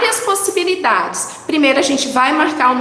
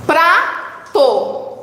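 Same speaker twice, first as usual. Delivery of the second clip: "Prato" split as PRA, pause, TO; syllable by syllable. Shown as a demonstration. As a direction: sound out both syllables of "Prato". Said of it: "Duas sílabas". ("Prato" separado em sílabas: pra... to)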